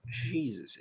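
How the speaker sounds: usual speaking voice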